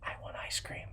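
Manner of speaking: hushed secret, sinking to a whisper; a kid confiding a secret up close; imitating child's whisper. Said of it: "He came in real close".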